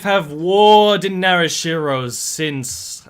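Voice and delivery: defiant character speech. Acted. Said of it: "and will say".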